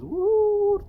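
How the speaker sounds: high, loud drawn-out hoot; owl hoot. Retold or imitated. Imitates owls heard outside.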